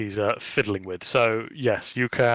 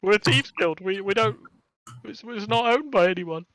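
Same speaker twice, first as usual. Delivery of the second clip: flustered kobold; 8 semitones higher than usual. Acted.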